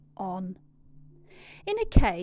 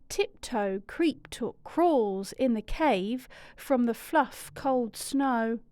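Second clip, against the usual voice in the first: ordinary delivery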